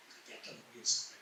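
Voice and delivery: murmúrio quase sem voz de quem está perdido; confuso, baixinho. Retold or imitated. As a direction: procure desorientado, num fio de voz. Perdido, bêbado, procurando a mesa. (confuso, bem baixinho)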